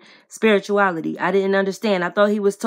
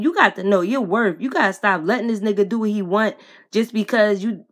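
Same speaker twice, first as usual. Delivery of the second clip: normal talking voice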